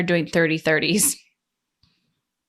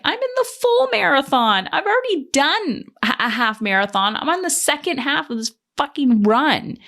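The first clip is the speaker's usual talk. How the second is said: indignant; 7 semitones higher than usual. Retold or imitated. Silent indignant retort to onlookers.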